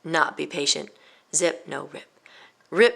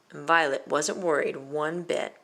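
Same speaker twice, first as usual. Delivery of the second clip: plain reading voice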